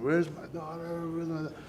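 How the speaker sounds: lightly imitating his panicked cries; a hint of a desperate father calling out; retold scream. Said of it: "I was screaming".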